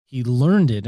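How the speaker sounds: "learned" hit hard and loud; stress on learned. Shown as a demonstration. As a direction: lean into the word "learned". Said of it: "I emphasize that word learned".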